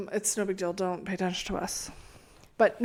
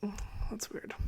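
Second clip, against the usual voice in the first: whispering; 9 dB quieter than usual. Pretend. Whispered reaction in ASMR chat.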